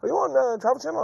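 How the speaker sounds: high-pitched questioning fan voice; imitated fan. Poker player asks if he is on television, imitated in a high voice. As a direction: raise the pitch to mimic a stranger. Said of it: "Someone goes".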